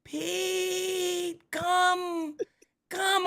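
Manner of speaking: high, drawn-out siren call; beckoning voice. Acted. Store seems to call him from across the airport, high beckoning voice. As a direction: call out in a long, high, held note. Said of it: "I heard something saying".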